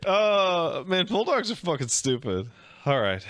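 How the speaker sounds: usual speaking voice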